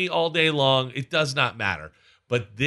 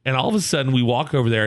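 ordinary talk

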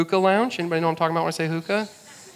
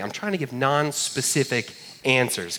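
ordinary talk, nothing marked

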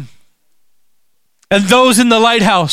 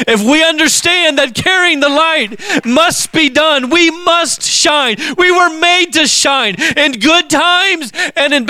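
excited yelling; 4 semitones higher than usual. Real, not acted. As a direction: shout it out, building excitement. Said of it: "I know I'm yelling because I'm excited".

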